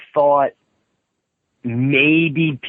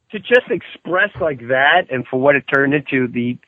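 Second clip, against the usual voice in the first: ordinary talk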